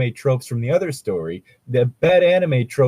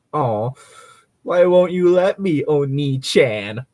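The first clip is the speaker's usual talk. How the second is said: put-on moe anime girl whine; 2 semitones higher, slower than usual. Rose begging her big brother in a slow, cutesy anime whine. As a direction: beg big brother sweetly, dragging out Oni-chan. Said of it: "that's irritating my throat".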